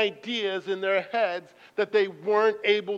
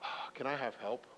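lower, softer stranger; mild stranger voice; 7 semitones lower, 14 dB quieter than usual. Retold or imitated. Stranger behind the wall asking for help, soft. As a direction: ask faintly for help. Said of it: "a guy on the other side of the wall says".

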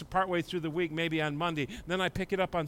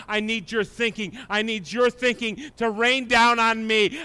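normal talking voice

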